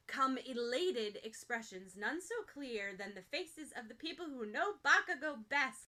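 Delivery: ordinary delivery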